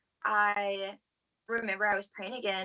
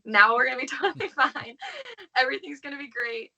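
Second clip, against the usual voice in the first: higher, laughing, a breezy carefree voice; mock naive optimism; 5 semitones higher, 4 dB louder than usual. Recalling her teenage self's cheerful hope, laughing and higher. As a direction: play your younger self sure the trouble is over. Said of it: "I was like".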